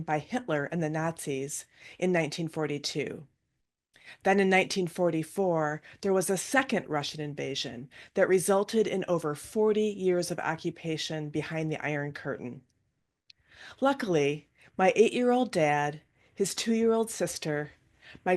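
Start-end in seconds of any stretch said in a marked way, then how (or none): none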